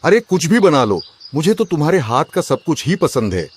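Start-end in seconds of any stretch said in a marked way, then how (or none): none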